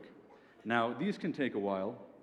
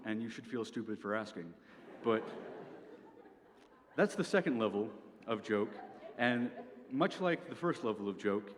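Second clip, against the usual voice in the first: usual speaking voice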